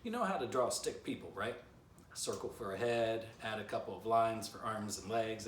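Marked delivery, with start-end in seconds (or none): none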